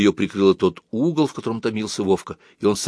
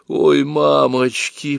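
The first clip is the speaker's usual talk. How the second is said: slow, higher mock groan; moaning; 6 semitones higher, 4 dB louder, much slower than usual. A narrator's reading. Nata moans at Fedya's plan, slow and higher. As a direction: stretch it out like a lament. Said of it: "простонала луна".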